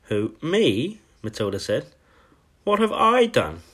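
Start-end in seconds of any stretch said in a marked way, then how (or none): 0.0-1.0 put-on higher-pitched, surprised little girl; startled schoolgirl. A narrator's reading. Accused Matilda answers the headmistress. 2.6-3.6 put-on much higher little-girl voice; protesting schoolgirl. A narrator's reading. Accused Matilda protests.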